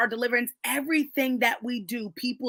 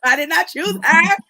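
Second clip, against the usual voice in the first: louder and higher, laughing through it; laughing protest; 5 semitones higher, 9 dB louder than usual. Real, not acted. Denying the woman was her spiritual mother, laughing loudly. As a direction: protest with an amused edge.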